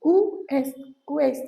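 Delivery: "ouest" said loud, with the final t clearly sounded; pronouncing the final t. Shown as a demonstration. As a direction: stress "ouest" and let the t land clearly. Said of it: "we pronounce it". ("ouest" का आख़िरी t साफ़ और ज़ोर से बोलो)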